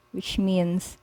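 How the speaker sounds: ordinary delivery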